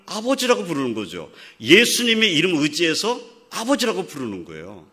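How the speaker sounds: impassioned Korean preacher; full-force preaching. Real, not acted. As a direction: preach it with rising fervour.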